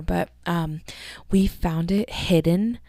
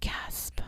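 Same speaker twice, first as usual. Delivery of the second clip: unvoiced, very soft spoken gasp; breathless mock gasp; 11 dB quieter than usual. Podcaster pretending shock at quitting, whispered gasp.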